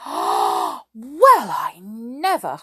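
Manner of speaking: drawn-out, swooping outrage; scandalised storybook exclamation; indignant cry. A narrator's reading. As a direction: cry it slowly in affront. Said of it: "cried Moonface, his big round face".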